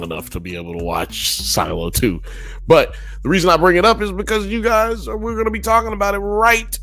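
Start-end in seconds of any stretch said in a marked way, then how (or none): 2.7-6.8 raised-pitch show announcer; host plugging the live show to viewers, pumped announcer voice; hype host promo. Acted.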